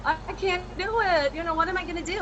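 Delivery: high whimpering lost-dog whine; poor little lost dog. Retold or imitated. Wife telling husband she can't do it, in a mock lost-dog whine. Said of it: "like a poor little lost dog going".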